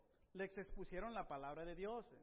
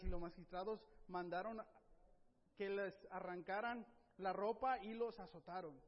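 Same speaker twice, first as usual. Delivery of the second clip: normal talking voice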